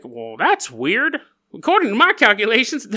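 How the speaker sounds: accidental hillbilly twang, rising at the end; hillbilly accent. Acted. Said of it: "That's like hillbilly".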